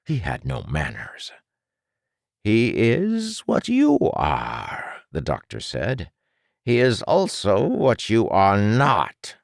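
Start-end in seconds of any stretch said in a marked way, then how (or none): 2.4-5.0 a lightly voiced smug doctor, slow and higher; condescending mad scientist, drawn out; smug villain doctor. 6.7-9.4 a lightly voiced smug doctor, slow and higher; condescending mad scientist, drawn out; smug villain doctor.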